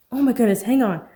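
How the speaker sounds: loud, high urgent cry; alarmed shout. Acted. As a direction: call down to her in alarm. Rescuer seeing her in water.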